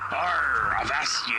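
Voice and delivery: put-on pirate accent. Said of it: "Your accent".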